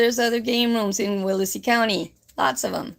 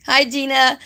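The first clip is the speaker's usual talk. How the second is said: ordinary talk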